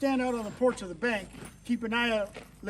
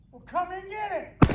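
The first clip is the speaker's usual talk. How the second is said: defiant dare; 9 semitones higher, 4 dB louder than usual. Acted. Boss defies the marshal.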